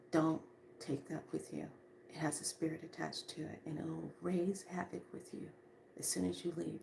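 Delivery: very soft, near-whispered caution; retold warning, dropped right down in volume; hushed spirit warning. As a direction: voice a spirit's secret warning under the breath.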